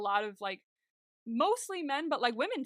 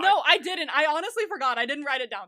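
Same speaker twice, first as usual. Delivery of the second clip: high, loud self-defence; loud protest; 5 semitones higher, 12 dB louder than usual. Real, not acted. Denying to co-host skipping it on purpose, loud protest. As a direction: protest innocence over the other person, raised voice. Protesting co-host's teasing accusation.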